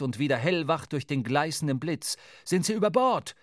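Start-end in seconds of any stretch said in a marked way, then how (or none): 2.4-3.4 high, loud shouted question; urgent call. A narrator's reading. Starbuck to the crew about the chains, urgent shout. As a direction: call across the deck in alarm. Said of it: "rief Starbuck der Mannschaft zu".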